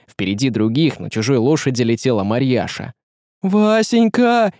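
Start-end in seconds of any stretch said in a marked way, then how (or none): 3.4-4.5 high, long drawn-out cry; emotional exclamation. A narrator's reading. Марьяша leaps down to Василий, high emotional cry. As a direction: call a loved one's name high and long. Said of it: "воскликнула она".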